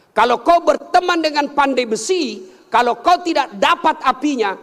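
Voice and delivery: high, forceful exhortation; fervent preaching. Real, not acted. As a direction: drive the parable home to the crowd. Preacher exhorts crowd at full force.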